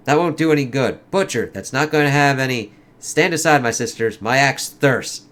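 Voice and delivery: lightly acted comic dialogue, in character; comic characters voiced. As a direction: read it like a dramatic comic scene. Reads battle scene.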